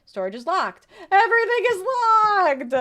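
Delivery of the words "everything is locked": loud, high frustrated outburst; exasperated shout. Real, not acted. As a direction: yell it in exasperation. Frustrated at locked doors in game.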